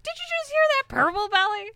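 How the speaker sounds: high, loud outraged mechanic; indignant Kaylee. Retold or imitated. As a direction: shriek it in offended disbelief. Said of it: "Kaylee just not giving any fucks and is like".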